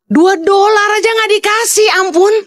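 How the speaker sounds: high, drawn-out wail of exasperation; indignant outcry. Real, not acted. Speaker complaining about being refused two dollars, indignant. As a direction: cry out very high, stretching the words in disbelief.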